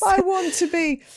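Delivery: insist, half-joking, in a high whine; high insistent whine. Real, not acted. To a friend saying she needn't be professional, a high insistent whine.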